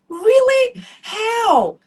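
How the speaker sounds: high, loud incredulous cry; indignant high-pitched disbelief; shocked disbelief. Retold or imitated. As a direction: relive the shock of being told you did it all wrong. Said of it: "I'm like".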